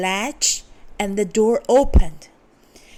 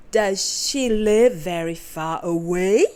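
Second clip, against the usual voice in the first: lightly voiced sly wolf; a hint of a wolf asking sweetly; 2 semitones lower than usual. A narrator's reading. Wolf asking the girl about grandmother, lightly voiced. Wolf voice in read-aloud story.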